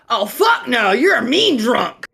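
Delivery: loud, high, indignant punchline; hammed-up indignant outburst; emphatic refusal. Punchline of the joke, acted.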